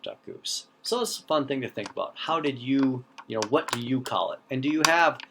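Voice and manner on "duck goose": whispered aside. Shown as a demonstration. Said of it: "everybody else apparently grew up saying".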